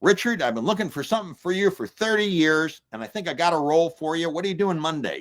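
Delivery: high-pitched impression of a film director; high, businesslike phone voice; David Lynch. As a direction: pitch it well up, like a director making an offer over the phone. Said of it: "And he goes".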